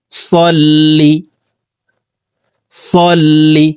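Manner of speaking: সল্লি with a thick, heavy emphatic s; slow সল্লি with a heavy s; emphatic sad sound. Shown as a demonstration. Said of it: "সদ হরফকে মোটা করে উচ্চারণ করব".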